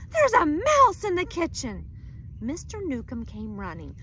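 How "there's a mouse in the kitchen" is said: much higher, much louder cry; wife yelling to husband, terrified; alarmed shout. Acted. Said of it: "shouted Missus Newcomb".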